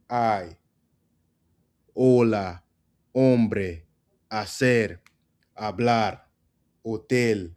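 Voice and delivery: Hola, Hombre, Hacer each begun on the vowel; silent H drill. Shown as a demonstration. Teacher recapping for learners, "Hola" to "Hambre" all silent H. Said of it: "when a word starts with H".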